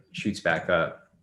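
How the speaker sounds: normal talking voice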